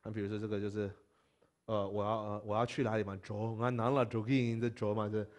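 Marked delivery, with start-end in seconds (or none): none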